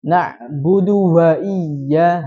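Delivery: Na'budu wa iya drawn out, wa in a medok way; slow recitation. Shown as a demonstration. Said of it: "wa menjadi wa". (tilawah pelan dengan wa medok)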